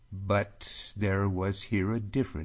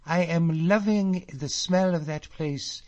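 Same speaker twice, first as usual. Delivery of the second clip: high, loud fond voice slowing at the end; girl longing for her glens; 7 semitones higher, 3 dB louder than usual. A narrator's reading. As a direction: linger lovingly on the place. Catriona longing.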